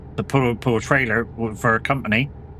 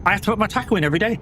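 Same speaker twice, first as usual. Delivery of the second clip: fast, higher, pushing back hard; heated retort; 7 semitones higher, much faster than usual. Retold or imitated. Telling the bosses off over his taco hours, fast heated retort. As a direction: rattle it off high and indignant.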